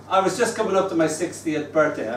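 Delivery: ordinary delivery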